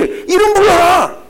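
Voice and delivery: slow, loud, high command; commanding shout. Acted. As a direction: call it out slowly. Preacher commands congregation. (천천히 외치는 명령)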